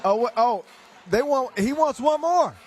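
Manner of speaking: rising excited call. Real, not acted. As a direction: lift the voice in surprise. Dunker asks for another try.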